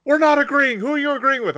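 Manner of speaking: loud, very high indignant protest; hammed-up exasperated outburst; mock outraged. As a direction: act out a cartoon squabble at full pitch. Countries arguing in retold anime scene.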